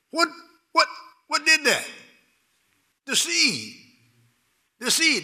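high, loud rhetorical answer; emphatic preacher call. Acted. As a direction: call it out to the church.

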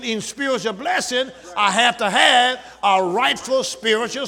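building pulpit shout; preaching at full force. Acted. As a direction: call the church to attention with fire. Pastor preaching on spiritual blessing.

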